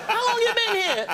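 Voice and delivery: high, incredulous squeal; startled question. Retold or imitated. Chris finds Michael hiding at movies, startled. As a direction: ask in high-pitched shock.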